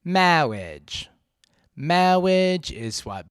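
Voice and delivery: "Marriage." then a pause, then "Marriage is what?" slow and high; dramatic pauses. Shown as a demonstration. Coach demonstrates the priest's dramatic pauses. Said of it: "don't forget pauses for dramatic effect".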